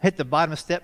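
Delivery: ordinary talk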